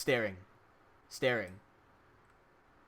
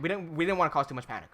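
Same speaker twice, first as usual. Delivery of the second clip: ordinary delivery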